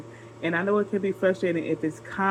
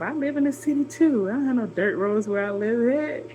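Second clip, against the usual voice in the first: indignant retort; 6 semitones higher than usual. Retold or imitated. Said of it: "I'm thinking to myself".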